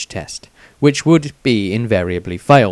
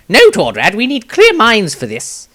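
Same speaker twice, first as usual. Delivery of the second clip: higher, louder firm rebuke of a companion; stern wizard voice; 11 semitones higher, 6 dB louder than usual. A narrator's reading. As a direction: put the brakes on his friend's hopes. Maestro voiced in story.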